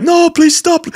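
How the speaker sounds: high mock plea; caricatured streamer breaking up a squabble. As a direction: beg in a hammed-up high voice. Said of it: "And he's like".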